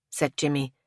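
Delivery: usual speaking voice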